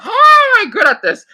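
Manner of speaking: high, loud cry of frustration; exasperated outburst. Real, not acted. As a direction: burst out, pitch leaping way up. Frustrated at her own failed attempts.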